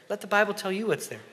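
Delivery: ordinary delivery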